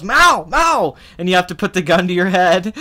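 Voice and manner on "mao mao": high, loud shouted "Mao!"; mock yell. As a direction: bark it like the slapping captor. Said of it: "slaps you, and goes".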